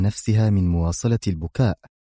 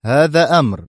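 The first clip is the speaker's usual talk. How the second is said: louder, higher, firm command; stern order; 6 semitones higher, 5 dB louder than usual. A narrator's reading. Adham ordering Mona to leave, stern. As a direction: shut the argument down. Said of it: "صاح بها في صرامة".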